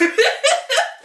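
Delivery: squealing laugh; high laugh. Real, not acted. Laughing at surprise.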